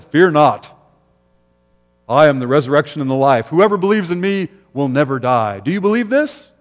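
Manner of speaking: loud, solemn proclamation; proclaiming Jesus. Acted. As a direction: boom it out as a promise. Preacher voices Jesus proclaiming.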